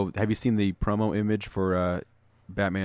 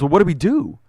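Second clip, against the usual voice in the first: panicked cop; 8 semitones higher, 8 dB louder than usual. Retold or imitated. Cops retold facing Aquaman.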